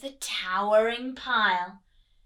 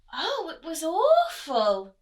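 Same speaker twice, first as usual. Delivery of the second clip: sighing; 4 semitones higher, 9 dB louder, slower than usual. A narrator's reading. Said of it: "she sighed".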